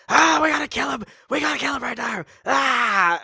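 high frantic yelling, scenery-chewing; shouty overacting. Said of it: "he was getting a bit shouty".

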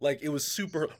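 normal talking voice

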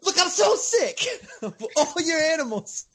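fed-up whine broken by laughter; exasperated Jonah. Retold or imitated. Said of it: "Jonah's like".

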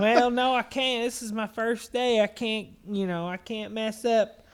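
high, loud cashier voice; exaggerated retelling of a cashier refusing; mocked cashier. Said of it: "And she's like".

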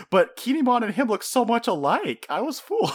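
high, squeaky mock old man voice. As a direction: ham up a doddering old skeleton. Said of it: "the old man racist, going like".

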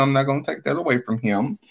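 ordinary delivery

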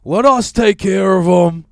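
loud mock New York street rant; 1970s New Yorker on the news. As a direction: bellow it like an angry seventies New York crowd. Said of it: "every single clip is like".

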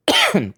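dry hacking cough; one sharp dry cough; coughing fit. Real, not acted. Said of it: "like, dry cough".

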